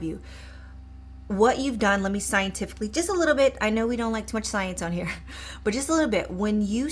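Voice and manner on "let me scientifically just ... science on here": ordinary delivery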